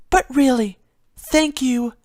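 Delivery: a timid pony fretting over a broken vase; Fluttershy's voice. Acted.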